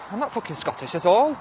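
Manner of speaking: high-pitched, emphatic protest; indignant denial. Real, not acted. Streamer tells a commenter they are not Scottish, indignant.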